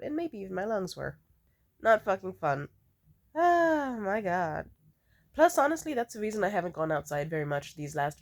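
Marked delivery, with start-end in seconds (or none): none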